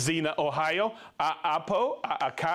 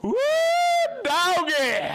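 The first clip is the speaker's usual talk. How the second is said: whooping holler; 5 dB louder than usual. Real, not acted. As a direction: holler it out high and long. Hollering about Tijuana.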